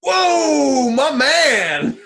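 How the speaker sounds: loud, high cheer; excited shout. Real, not acted. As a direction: whoop with delight. Cheering friend's low score.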